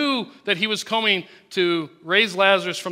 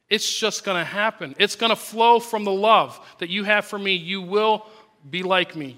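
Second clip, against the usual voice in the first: ordinary talk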